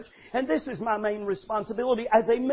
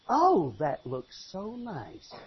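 slow, soft, admiring praise; a lightly voiced wife praising her husband's job; 2 semitones lower, 4 dB quieter, slower than usual. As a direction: gush gently over his work. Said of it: "comes and says".

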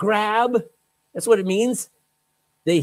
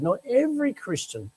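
ordinary delivery

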